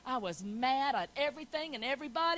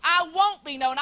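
defiant vow; 11 dB louder than usual. Retold or imitated. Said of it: "I said".

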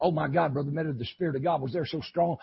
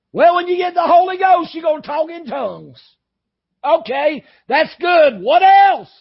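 high, loud preacher's challenge; full-force preaching; 12 semitones higher, 13 dB louder than usual. Acted. Preacher challenging the tongues talkers, full-force preaching.

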